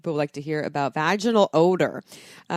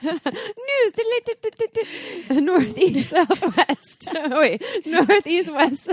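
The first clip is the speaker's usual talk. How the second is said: laughing; 10 semitones higher, 3 dB louder, much slower than usual. Real, not acted. Fumbles compass, laughing.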